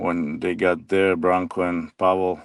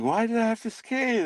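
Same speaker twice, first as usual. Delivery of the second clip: much higher, indignant whiny teammate; high-pitched whine; 13 semitones higher than usual. Retold or imitated. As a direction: squeak out the complaint. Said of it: "and he was like".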